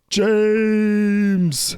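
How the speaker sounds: high loud mock call of a name; imitating a shout. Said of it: "Is that how I said it?".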